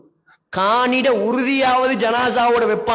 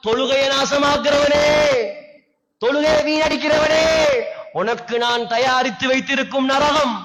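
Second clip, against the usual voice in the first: divine warning; 6 semitones higher than usual. Acted. Voicing God warning those who skip prayer, thundering. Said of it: "அல்லாஹ் சொல்றான்".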